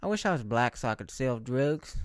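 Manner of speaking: ordinary talk, nothing marked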